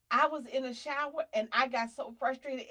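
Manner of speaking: usual speaking voice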